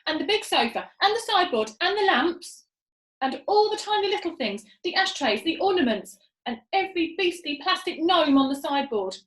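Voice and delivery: high, loud rapid orders; eager listing. A narrator's reading. Monkey father listing furniture to stick up, high and eager. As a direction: rattle off everything. Muggle Rump listing items.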